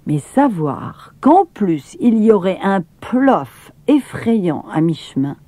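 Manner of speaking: ordinary delivery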